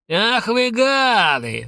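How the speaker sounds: slow, high, drawn-out roar. A narrator's reading. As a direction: bellow at the fleeing musicians. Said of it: "ревел Сабуров". (медленный протяжный рёв)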